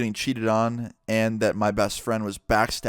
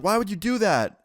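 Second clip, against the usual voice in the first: high-pitched mock-upset ex; high, put-on indignation; imitated protest; 9 semitones higher than usual. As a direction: mimic her outraged question, pitched way up. Shoved ex protests in retelling.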